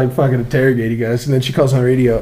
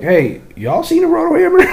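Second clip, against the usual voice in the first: hammed-up holler. Hollering at girls in back.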